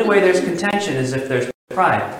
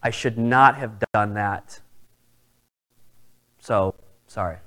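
sincere apology; 5 semitones lower, 4 dB quieter than usual. Retold or imitated. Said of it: "I look at him and I say".

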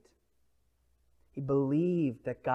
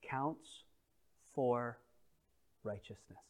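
hushed declaration; 2 semitones lower, 6 dB quieter, slower than usual. Acted.

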